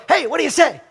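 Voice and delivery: bright, high, tossed-off hello; energetic greeting. Retold or imitated. Pastor greeting a college student, energetic and high. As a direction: call it out like a friendly passing hello. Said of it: "what I consider just to be an energetic, like, greeting".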